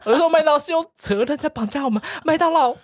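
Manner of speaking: hammed-up tearful complaint to the manager; mock crying whine. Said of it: "我的脸是真的是皱在一起".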